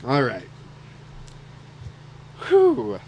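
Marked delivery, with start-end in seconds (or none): 2.5-3.0 high breathy whew after tears; relieved exhale. Real, not acted. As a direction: shake off the emotion. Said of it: "that got real".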